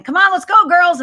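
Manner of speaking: put-on loud, high dad yelling for his girls; retold yell. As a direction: call out sharply to hurry them along. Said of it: "my dad yells again".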